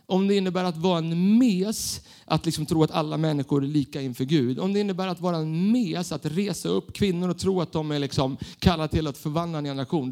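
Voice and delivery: normal talking voice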